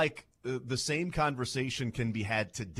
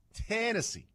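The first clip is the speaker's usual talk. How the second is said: TEN in Tennessee pitched way up, then dropping; first-syllable stress; 10 semitones higher than usual. Shown as a demonstration. Host repeating his demonstration, exaggerated first-syllable stress on Tennessee. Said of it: "The emphasis on the first syllable here".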